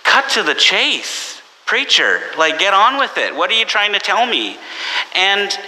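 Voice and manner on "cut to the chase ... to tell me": high, exasperated get-on-with-it voice; impatient disciples. Retold or imitated. Disciples impatient with rambling Jesus, exasperated and high. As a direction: hurry the preacher along, pitched up high. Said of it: "the disciples are like".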